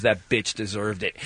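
low, slow deadpan; dead serious. Retold or imitated. Friend in the parking lot, not laughing, dead serious. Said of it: "Mike dead serious goes".

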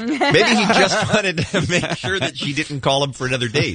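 laughing. Real, not acted. Laughing while talking.

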